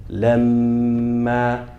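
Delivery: Lamma, mim doubled and held two counts. Shown as a demonstration. Teacher modelling Lamma with the doubled mim held. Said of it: "it should sound like this".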